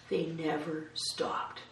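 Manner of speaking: softly, letting each word land slowly; hushed and slow, for weight; slow quiet repeat. Acted. Preacher to congregation, a slow quiet repeat.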